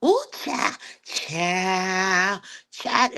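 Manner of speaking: high, put-on sing-song catchphrase; mock his flamboyant exclamation up high; hammed-up campy holler; imitating Sir King. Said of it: "he over there".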